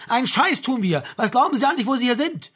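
furious, high-pitched shouting; enraged officer's tirade; bellowing. A narrator's reading. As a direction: keep yelling, cutting the other man off. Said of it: "hochrot von der Brüllerei".

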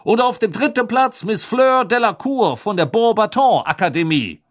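high, loud booming announcer; magically amplified voice. A narrator's reading. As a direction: announce to the whole stadium. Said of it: "seine magisch verstärkte Stimme hallte".

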